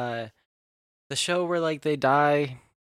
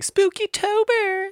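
mock spooky holler, very high and loud; slow, high, loud catchphrase imitation; imitated catchphrase call; 7 dB louder, slower than usual. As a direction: mimic a drawn-out haunted announcement. Quotes the catchphrase call back.

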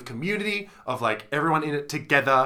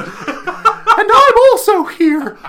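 much higher, slow, louder put-on voice; silly high voice; 14 dB louder, much slower than usual. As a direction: squeak it out in a goofy character voice. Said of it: "Such range on this guy".

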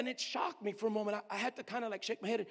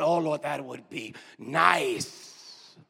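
longing sigh; 8 semitones lower, 5 dB louder, much slower than usual. Retold or imitated. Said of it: "I said".

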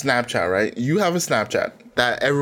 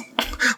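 high giggle; 13 semitones higher than usual. Real, not acted. Laughing mid-read.

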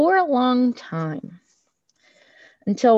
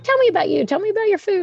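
much higher, quizzing sponsor voice; sponsor's voice; 10 semitones higher, much faster than usual. Retold or imitated. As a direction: play the sponsor probing gently, pitched up. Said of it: "She's like".

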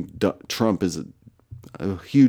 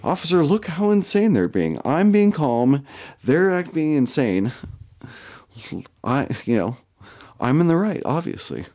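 mock innocent plea; 6 semitones higher than usual. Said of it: "Just to be like".

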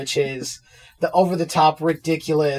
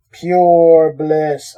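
louder, boomy into the mug; 9 dB louder than usual. Real, not acted. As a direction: lean into the mug and boom it. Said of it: "I'm speaking into the mug".

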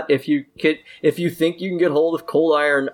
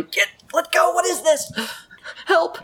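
shaking off a spider. Acted. Kid struggling against servant.

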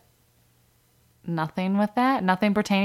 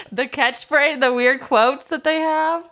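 laughing; 9 semitones higher, 5 dB louder than usual. Real, not acted. Guest guessing, amused.

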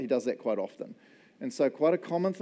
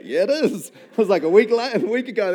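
laughing admission; 6 semitones higher, 9 dB louder than usual. Retold or imitated. Admitting the lamb was their sheep.

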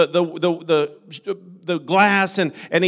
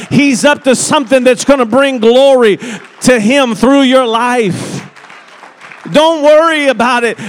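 loud, high, building preacher's cry; fiery pulpit preaching, rising loud; full-force preaching; 5 semitones higher, 8 dB louder than usual. Acted. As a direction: preach it hard and lift it to a shout.

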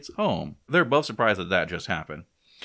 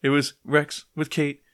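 mock distraught Eve; 6 semitones higher, 4 dB louder than usual.

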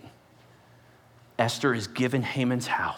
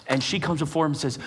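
ordinary talk

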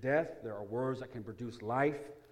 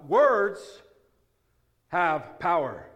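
loud, high words then slow have power; preached emphasis; 4 semitones higher, 9 dB louder, much slower than usual. Acted. Pastor hammering his main point to the church, slow and loud. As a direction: drive the point home slowly.

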